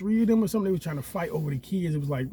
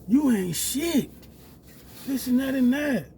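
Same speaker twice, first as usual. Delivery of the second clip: high, loud mocking voice of the girlfriend; shrill-voiced nagging imitation of her demands; mimicking his girlfriend; 9 semitones higher, 4 dB louder than usual. Girlfriend demanding bill money, mocked high and loud. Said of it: "She like".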